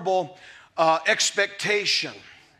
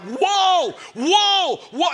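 retold yelling; 7 semitones higher, much slower than usual. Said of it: "I'm yelling at this water buffalo horse".

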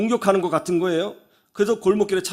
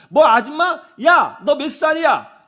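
acted outburst, high and loud; retold angry quarrel; 7 semitones higher, 6 dB louder, slower than usual. Woman fighting another driver over being called ajumma, angry shouting. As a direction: flare up in a mock roadside fight. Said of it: "그러면서 막 싸운 거죠".

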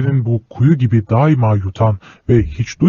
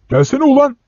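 much higher, yelled summons; rough shout; 13 semitones higher than usual. Acted. Man at the train window yelling at the boy, rough shout. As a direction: bark him over rudely. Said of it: "diye bağırıyordu".

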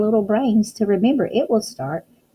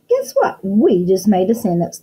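excited reveal; 5 dB louder than usual. Acted.

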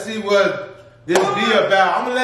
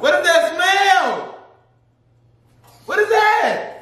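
high, slow, shouted disgust; disgusted surprise; 7 semitones higher, slower than usual. Real, not acted. Reacting to food smell.

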